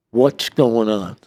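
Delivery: slow, loud acted demand; demanding question. FBI agents question the inmate they pulled out, demanding. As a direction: press for an answer, slowly and forcefully.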